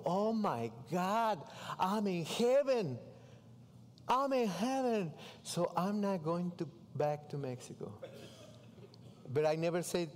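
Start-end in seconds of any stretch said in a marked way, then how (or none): 0.0-5.1 drawn-out, higher-pitched delight; retold elation. Man retelling his delight at house and cars, elated. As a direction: savour each word in mock rapture.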